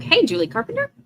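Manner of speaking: ordinary delivery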